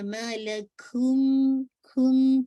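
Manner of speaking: ما لکھم very slow, the م held nasal; held nasal ghunna. Shown as a demonstration. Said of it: "یہ آپ کا جو ہولڈنگ ہے غنہ کا".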